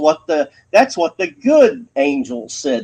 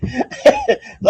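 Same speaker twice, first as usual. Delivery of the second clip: laughing through words. Real, not acted. Still laughing.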